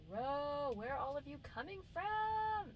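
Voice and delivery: drawn-out, very high-pitched complaint; high exasperated whine. Real, not acted. To the creatures infesting the place, high exasperated wail.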